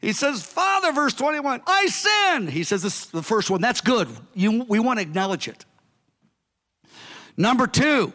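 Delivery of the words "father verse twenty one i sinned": high pleading son; pitched-up penitent son; humble son's plea. Retold or imitated. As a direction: beg like the returning son. Son voiced in parable.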